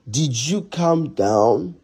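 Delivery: very slow, drawn-out challenge; pointed demand. Acted. Husband pressing wife about calming down, slow accusing question. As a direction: stretch every word of the accusing question.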